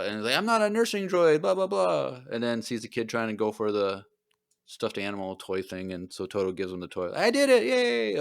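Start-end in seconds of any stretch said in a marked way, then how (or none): 0.4-2.2 high, fussy little droid; mock droid voice. Droid protesting to the bounty hunter, high mock droid voice. 7.1-8.2 high, slow triumphant little droid; mock droid cheer. Droid pleased after calming the crying kid, high slow cheer.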